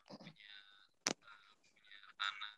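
faint and far from the mic; phone held away. Real, not acted. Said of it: "Ada, tapi kecil banget".

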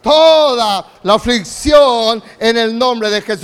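slow, loud shouted proclamation; loud shouted declaration. Real, not acted. Preacher shouting a declaration for the church to repeat. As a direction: shout it out slowly in Jesus' name. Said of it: "Diga bien fuerte".

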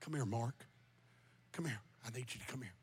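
very soft, low call; low, near-murmured request; hushed summons. Real, not acted. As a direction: quietly call someone close. Softly calling brother up front.

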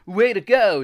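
loud, high cheer; excited cry. A narrator's reading. Jack cheering his friend at the chest, excited cry. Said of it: "Jack cried".

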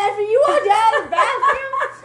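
laughing. Real, not acted. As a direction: crack up while teasing. Teasing friend while laughing hard.

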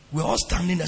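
usual speaking voice

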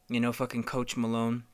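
normal talking voice

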